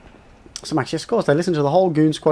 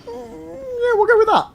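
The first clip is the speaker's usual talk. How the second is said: high mock falsetto, sarcastic; squeaky falsetto; slower than usual.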